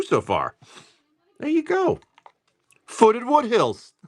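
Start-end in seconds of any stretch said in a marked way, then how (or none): 1.4-2.0 a goofy falsetto to celebrate the card; playful high-pitched cheer; squeaky high voice. Acted. Silly high voice while opening cards. 2.9-3.9 high and slow, jokingly mangling the name; hammed-up card announcement; silly drawn-out voice.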